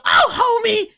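very high, loud cartoon wife voice; Marge Simpson. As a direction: scold like a cartoon mother breaking up a fight. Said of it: "Marge has to come over and go".

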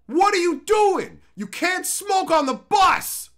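loud, high, drawn-out shout; yelling a reprimand across a bus; re-enacted yelling. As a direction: call out a smoker with outraged, stretched words. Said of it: "do some more yelling".

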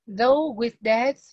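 slow reread for the class; reread slowly. Shown as a demonstration. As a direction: reread the phrase slowly before translating. Rereads sentence to translate.

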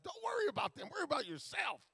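a hint of the pastor's correction, high. Pastor telling him to mind himself, lightly voiced and high. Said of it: "But like".

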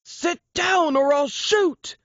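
high, slow, yelled threat. Acted. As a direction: shout the ultimatum, at the edge. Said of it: "the gunman's words grew louder".

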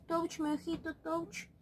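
usual speaking voice

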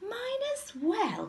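high, drawn-out cry of dismay; slow, high-pitched bear mother's wail; Mummy Bear. A narrator's reading. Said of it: "cried Mummy Bear".

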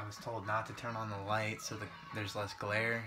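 usual speaking voice

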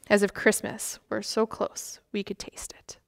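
usual speaking voice

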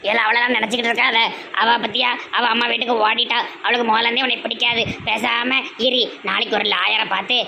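put-on a cunning old mother coaxing her son; scheming mother.

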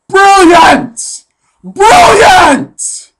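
high, loud triumphant yell of BRILLIANT; excited shout. Real, not acted. Shouting praise for his own meat squares idea, high and loud. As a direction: crow it like a mad genius.

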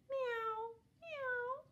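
high thin kitten mewing; tiny kitten meow. A narrator's reading. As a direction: mew like a small kitten. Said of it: "He could only go".

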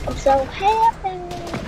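high, slow, drawn-out happy cry; overjoyed. Real, not acted. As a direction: stretch it out in pure delight. Just got the item.

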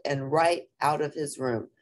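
ordinary delivery, nothing marked

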